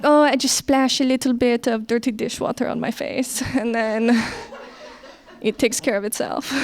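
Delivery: airy, offhand mock French roommate; breezy French roommate. Scene: the French roommate shrugs off her beauty routine, breezy. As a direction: toss it off like it's nothing. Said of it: "she's like".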